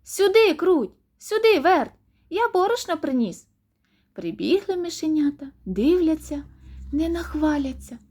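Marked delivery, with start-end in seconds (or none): 0.0-3.5 high, loud rooster summons; raised, ringing calling voice. A narrator's reading. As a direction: call the little ones over. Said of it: "знову кличе мишенят".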